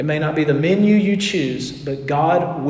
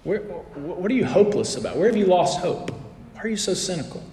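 a quieter, softer counselor asking; counselor's gentle probing; 8 dB quieter than usual. Retold or imitated. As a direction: gently press him where he lost hope. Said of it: "my counselor told me".

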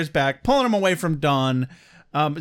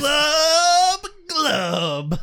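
mock sobbing glub; 10 semitones higher than usual. Imitating gulping, crying.

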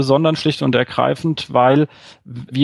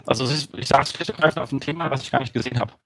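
robot voice; 6 dB quieter than usual. Real, not acted. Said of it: "jetzt ist die Roboterstimme wieder da".

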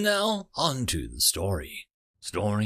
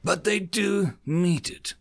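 higher, slower biting reply; snapped retort; 8 semitones higher than usual. A narrator's reading. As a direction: snap it back pointing at him. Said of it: "the Cravil snapped".

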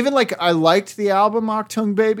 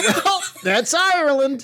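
laughing; 8 semitones higher, slower than usual. Real, not acted. Riffing catchphrase, laughing.

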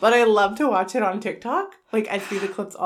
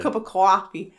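put-on Boston accent; 3 dB louder than usual. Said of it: "in the Boston accent".

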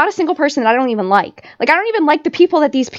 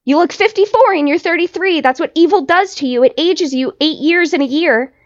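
ordinary talk, nothing marked

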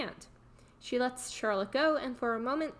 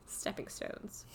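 whispering; 9 dB quieter, slower than usual. Real, not acted. Whispered aside while recapping.

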